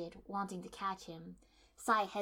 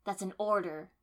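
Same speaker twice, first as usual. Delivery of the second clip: firm order; 8 dB louder than usual. A narrator's reading. Command to Sai.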